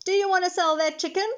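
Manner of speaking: high, loud, fast street call. A narrator's reading. Cooking lady wants to buy the chicken, calling out. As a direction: call it quickly across the street. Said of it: "called a lady".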